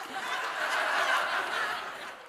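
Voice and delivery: breathy high-pitched burst of laughter. Real, not acted. Laughing at the joke.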